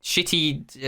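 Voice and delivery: ordinary delivery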